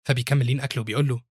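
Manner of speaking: plain reading voice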